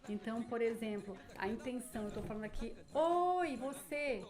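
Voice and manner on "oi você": loud, higher hail to a passerby; calling out to someone. Shown as a demonstration. Teacher demos a street vendor hailing a passerby. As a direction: call out to catch a stranger's ear. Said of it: "a forma como eu falo".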